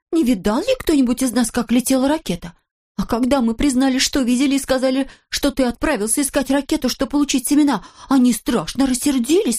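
high-pitched anxious reporting; villagers complaining. A narrator's reading. Villagers telling Kolosok of police, anxiously. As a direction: pitch it up and fret through the tale.

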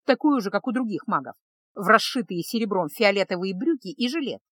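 plain reading voice

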